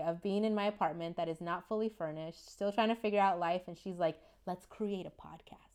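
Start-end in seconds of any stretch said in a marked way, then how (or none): 4.5-5.7 hushed, breathy pitch of a plan; conspiratorial whisper. Retold or imitated. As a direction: whisper it like a secret idea. Quoting friend's idea.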